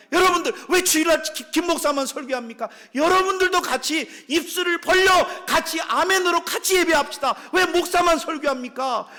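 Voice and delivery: 높고 크게 외치며 따지는 교인 흉내; 성도들이 목사에게 따지듯 크게 외침; impassioned shout. Retold or imitated. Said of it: "우리 교인들이 그럽니다". (교인들 말을 흉내 내며 높여 외치기)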